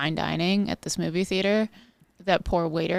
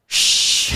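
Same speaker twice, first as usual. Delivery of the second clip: sharp, loud shush at a talker; 6 dB louder than usual. Shown as a demonstration. To movie talkers nearby, a loud passive-aggressive shush. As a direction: shush the chatterer hard. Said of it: "I'll do the".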